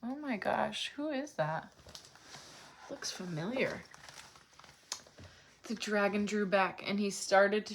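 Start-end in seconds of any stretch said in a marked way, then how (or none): none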